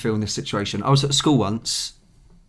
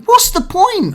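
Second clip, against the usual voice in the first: exasperated; 5 dB louder, slower than usual. Retold or imitated. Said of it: "and I'm like".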